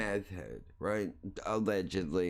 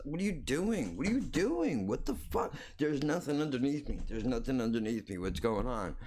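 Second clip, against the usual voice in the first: higher, frantic mock cat voice; hammed-up frightened cat; panicking cat; 4 semitones higher than usual. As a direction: play a cat dangling in alarm, stammering. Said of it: "the cat is like".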